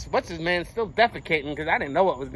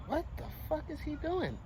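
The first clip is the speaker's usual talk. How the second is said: higher, softly spoken bafflement; hushed disbelief; 7 semitones higher, 11 dB quieter than usual. Retold or imitated. Man retelling his shock at the stranger, hushed disbelief. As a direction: puzzle it out under your breath.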